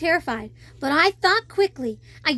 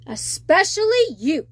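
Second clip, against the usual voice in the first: pointed, louder royal warning; single her out sternly; stern father; 3 semitones higher, 4 dB louder than usual. Acted. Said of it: "he said".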